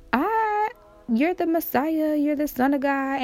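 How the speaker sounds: high-pitched retold answer; eager mock declaration. Peter answering who Jesus is, high mock declaration. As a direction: start very high and come down gradually.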